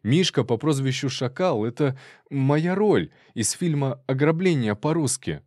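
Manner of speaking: high-pitched actor justifying himself; anxious robber explaining. Acted. Robber's voice explaining his film role.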